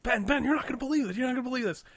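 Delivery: high, eager rush of news; hyped-up retold announcement; feigned excitement. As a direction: act bursting to tell your brother. Acting out bursting in with news.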